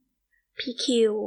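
pained call. Acted. Girl calls after departing lover.

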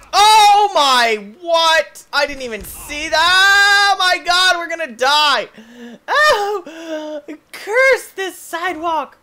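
loud, high, drawn-out screaming protest; panicked shouting. Real, not acted. Player cursing the sidewalk about to kill him, panicked shouting. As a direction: yell as if about to crash.

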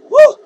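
loud, high whoop. Real, not acted. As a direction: whoop after a stiff drink. Whooping after a drink.

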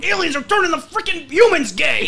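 laughing mock conspiracy yell; mock conspiracy shout. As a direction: yell it like a crazed conspiracy host.

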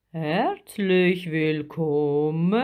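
slow, booming welcome from a huge creature; big, drawn-out monster greeting; deep voice. A narrator's reading. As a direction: stretch every syllable in a big creature voice. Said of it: "hörte sie plötzlich eine tiefe Stimme sagen".

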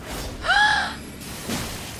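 loud, very high shrieking gasp; shocked gasp. Real, not acted. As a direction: gasp sharply in outrage. Outrage at a game setback.

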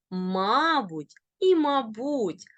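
мабуть stressed on ма, then on буть; double stress. Shown as a demonstration. Teacher showing both allowed stresses, double stress. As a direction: slowly say мабуть with front stress, then end stress. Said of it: "можуть наголошуватися бінарно".

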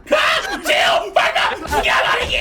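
yelling kill orders mid-fight; frantic shouting. Real, not acted. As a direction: scream the callouts urgently.

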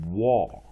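"war" said loud and clear as the right form; model word war. Shown as a demonstration. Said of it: "它是念".

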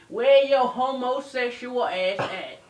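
slow, loud retold yell; yelled demand. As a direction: imitate her hollering for him. Girl's demand in retold quarrel.

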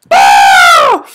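loud low scare shout; real fright shout; startled yell. Real, not acted. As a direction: yelp at the jump scare. Jump scare.

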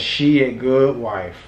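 slow, stretched teasing read; drawn-out teasing. Retold or imitated. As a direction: drag out the comment to tease. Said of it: "Somebody comment says".

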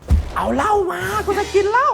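put-on high, slow drunken yell; drunk shout. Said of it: "ตะโกนใส่มันใช่ปะ".

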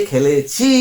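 "খেলেছি" slow, with a long final "ছি"; stressed suffix. Shown as a demonstration. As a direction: stretch out the ending of "খেলেছি". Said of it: "এচি দিয়ে". ("খেলেছি"-র "ছি" টেনে, ধীরে)

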